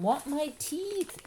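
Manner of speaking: high, drawn-out wail; screaming toddler. A narrator's reading. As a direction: wail slowly like a small boy demanding. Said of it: "Fudge started to scream".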